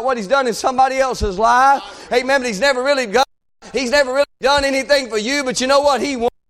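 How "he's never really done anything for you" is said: ordinary talk, nothing marked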